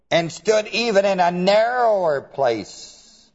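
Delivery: usual speaking voice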